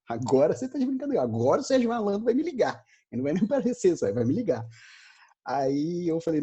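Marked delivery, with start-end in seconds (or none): 0.1-4.6 pitched much higher, half-laughing incredulity; lightly hammed-up outrage at the attendant; mock exasperated disbelief. Retelling the call to the audience, mock exasperated disbelief.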